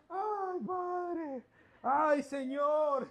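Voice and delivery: high, drawn-out mock wail; mock weeping. Preacher voices the king weeping to God. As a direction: ham up the king's crying. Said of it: "yo voy a hacer tu llanto".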